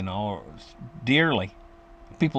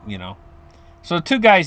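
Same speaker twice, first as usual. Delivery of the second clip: ordinary talk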